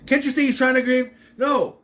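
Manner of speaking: indignant scolding. Retold or imitated. He shushes Charlotte for interrupting a grieving brother, indignant.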